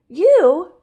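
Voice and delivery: high, loud startled question; surprised cry. A narrator's reading. The animals startled, crying out in surprise. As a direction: jump at the news. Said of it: "they cried".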